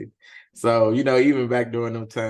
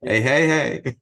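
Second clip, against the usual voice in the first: catchphrase impression; 3 semitones higher, 8 dB louder, slower than usual. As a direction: belt it out like the show. Host imitates the catchphrase.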